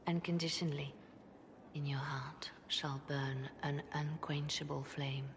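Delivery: soft, low and hushed, slowing gently; solemn reassurance. Acted. Would-be Divine promises all are loved, hushed solemn reassurance. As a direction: console with quiet conviction, sinking almost to a whisper. Solemn, tender sermon-like assurance.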